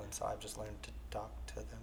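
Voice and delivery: soft trailing whisper. Real, not acted. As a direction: let it fade to a whisper. Trailing self-deprecating aside.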